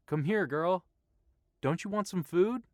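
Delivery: high, loud coaxing call; calling a dog. A narrator's reading. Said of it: "called again for his companion".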